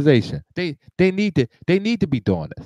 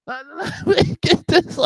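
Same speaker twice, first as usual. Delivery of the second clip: laughing; 10 semitones higher, much slower than usual. Real, not acted. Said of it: "the reason why I'm laughing".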